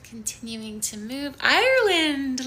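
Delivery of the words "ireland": high, loud, drawn-out country name; light up at a new viewer's country; excited greeting. Real, not acted. Yoga teacher greeting a livestream viewer, excitedly.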